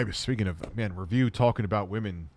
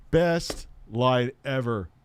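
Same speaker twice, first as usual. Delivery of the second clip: normal talking voice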